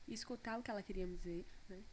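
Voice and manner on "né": ordinary talk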